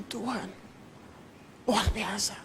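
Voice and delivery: soft whisper to God; inner prayer. Retold or imitated. Preacher retelling his silent prayer in awe, whispered. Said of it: "saya sampai dalam hati".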